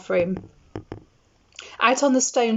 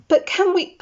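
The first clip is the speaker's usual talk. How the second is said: gasped; 11 semitones higher, 4 dB louder than usual. A narrator's reading. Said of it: "Hermione gasped".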